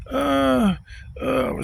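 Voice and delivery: high, drawn-out mock squeal; imitated girly squeal of protest. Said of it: "yelling ... squealing like he's some chick".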